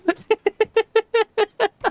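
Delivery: real laugh breaking up high; real laughter cracking upward; high burst of laughter. Real, not acted. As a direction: let out a squealing laugh. Big laugh at own joke.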